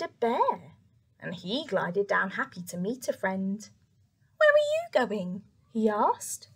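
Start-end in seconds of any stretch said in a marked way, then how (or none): 4.4-5.4 high, bright robin voice; little bird voice. Acted. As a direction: chirp it like a tiny bird.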